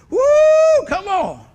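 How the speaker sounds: high whoop and urging; whoop, then cheer them on; jubilant cheer. Acted. Pastor picturing reunion with family in heaven, jubilant cheer.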